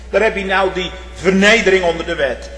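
usual speaking voice

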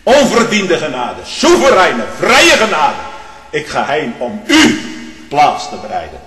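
loud, high, ringing pulpit cry; fiery Dutch preacher in full flight; preaching at full force. Acted. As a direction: thunder out the grace from the pulpit. Preaching climax on free grace.

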